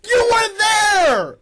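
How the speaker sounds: loud, high, drawn-out shout. Real, not acted. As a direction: yell it at him. Shouted accusation.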